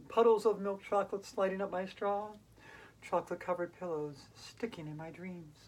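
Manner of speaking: lilting rhythmic reading; light recital of chocolate poem. Acted. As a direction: savor each line like candy.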